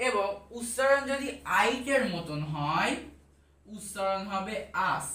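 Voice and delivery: slow, deliberate rule for students; slow teaching. Shown as a demonstration. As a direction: spell out the rule slowly and clearly. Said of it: "teacher states pronunciation rule". (ধীরে, যত্ন করে নিয়ম বোঝানো)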